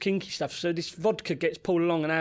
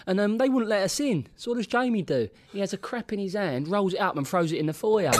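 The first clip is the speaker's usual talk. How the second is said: normal talking voice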